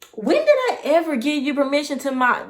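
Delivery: indignant. Retold or imitated. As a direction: tell him off, offended. Confronting uninvited visitor.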